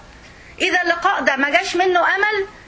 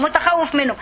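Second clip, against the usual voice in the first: ordinary delivery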